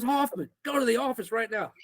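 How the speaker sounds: high-pitched angry retold command; stern coach order. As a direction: imitate a coach sending a student out.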